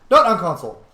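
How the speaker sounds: a sudden loud self-correction; stress on NOT. Real, not acted. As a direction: correct yourself by hitting the word NOT. Corrects own slip.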